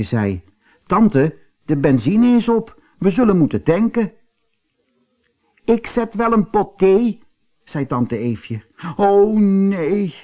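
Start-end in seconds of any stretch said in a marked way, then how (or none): 0.9-4.1 put-on higher-pitched story boy; boy's voice. A narrator's reading. 5.6-7.2 high-pitched chirpy aunt; aunt's voice. A narrator's reading. 9.0-10.1 high, drawn-out groan of dismay. A narrator's reading. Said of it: "kreunde Kootje".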